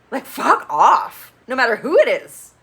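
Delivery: mock Mike snapping at her, loud and high; hammed-up angry telling-off; aggro and sassy. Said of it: "gets all aggro and sassy".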